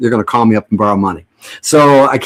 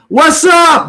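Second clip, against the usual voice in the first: loud, high shout-out to the fans; shouted greeting; 3 dB louder than usual. Real, not acted. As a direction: call out to them big and high. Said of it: "I'm gonna salute you guys".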